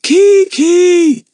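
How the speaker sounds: loud, high call; yelling. A narrator's reading. Lucy Ann yelling for Kiki, loud and high. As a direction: shout the name down the tunnel.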